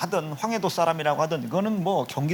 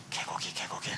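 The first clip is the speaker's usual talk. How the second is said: whisper; 12 dB quieter than usual. Acted. Joking whisper about dog meat.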